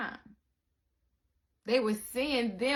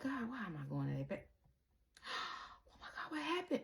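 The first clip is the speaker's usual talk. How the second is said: hushed guilty mutter; 11 dB quieter, faster than usual. Retold or imitated. Acted sneaking a look.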